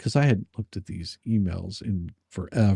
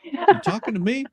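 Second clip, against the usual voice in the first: mock tough guy; 3 dB louder than usual. Mock tough-guy talking to self.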